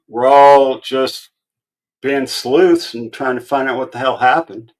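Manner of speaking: ordinary talk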